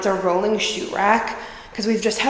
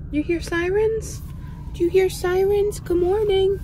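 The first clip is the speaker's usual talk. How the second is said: much higher cooing puppy voice; 12 semitones higher than usual. Real, not acted. Owner cooing at her dog in the car. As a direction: sing it sweetly to the dog.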